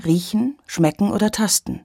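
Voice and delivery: ordinary delivery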